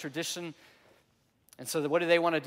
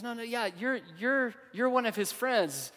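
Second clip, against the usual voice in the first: put-on girl insisting; 7 semitones higher than usual. Girl presses Peter, voiced higher.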